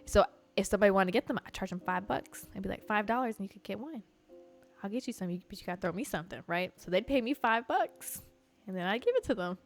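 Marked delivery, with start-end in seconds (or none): none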